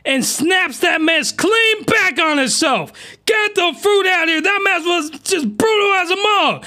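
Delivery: real hype reaction; excited outburst. Real, not acted. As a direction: shout it in disbelief. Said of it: "excited reaction to clip".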